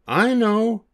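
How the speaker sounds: high, bright monkey voice with an idea; little monkey's idea. Acted. One little monkey announcing a plan, high and bright. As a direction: pipe up like a clever little monkey.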